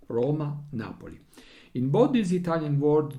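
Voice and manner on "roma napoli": "Roma, Napoli" stressed on RO and NA; first-syllable stress. Shown as a demonstration. Teacher giving two Italian example words, first syllables stressed. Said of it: "the accent falls on the first syllable".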